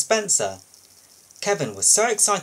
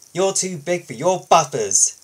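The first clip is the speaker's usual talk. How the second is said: huffy retort; 4 semitones higher, 6 dB louder than usual. Acted. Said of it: "Thomas puffed".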